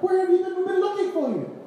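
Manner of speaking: high-pitched exasperated parental scolding; mock panicked parent. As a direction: demand it like a frantic parent. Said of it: "they were, like, panicking".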